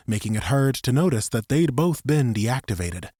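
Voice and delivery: ordinary talk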